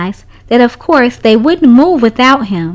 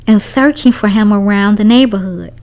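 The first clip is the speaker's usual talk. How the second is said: usual speaking voice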